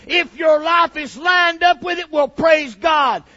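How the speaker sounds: loud, high, shouted preaching; fiery preaching. Acted. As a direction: preach it hard to the congregation. Preacher at full force.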